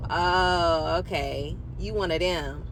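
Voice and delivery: low, dismissive voice of the man, a touch mocking; dismissive guy. Retelling the man's reply on the phone, low and dismissive. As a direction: drop low and sneer it out like the guy did. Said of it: "And then he just was like".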